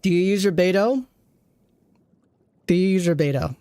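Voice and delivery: ordinary talk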